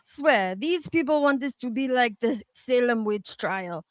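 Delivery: ordinary talk, nothing marked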